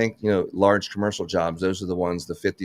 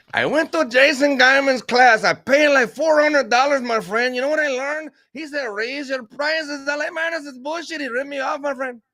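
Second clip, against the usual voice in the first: put-on Jose accent; 4 dB louder than usual. Worker griping to a friend about a pricey class, in a high mock Spanish accent. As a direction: play the ripped-off worker in a thick Spanish accent. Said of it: "Can you do that accent for Jose again".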